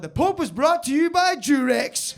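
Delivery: high, loud, drawn-out sponsor read; advert announcer. Acted. Mocking a workmate with a Netflix Mass advert, booming announcer. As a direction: belt it out like a mock TV sponsor tag.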